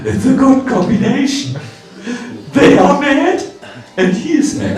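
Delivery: loud, high amused exclamation; imitated gleeful pronouncement; Advaitacharya, smiling. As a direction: deliver it as a delighted verdict. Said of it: "Advaitacharya smiled and said".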